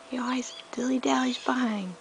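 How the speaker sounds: hushed whispered aside; whispering. Real, not acted. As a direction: whisper it to them.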